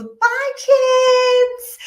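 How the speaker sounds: high sing-song mock-cheerful goodbye; fake happy mommy. Mother to kids at school drop-off, sing-song fake cheer. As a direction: chirp it like the happy mommy hiding tears.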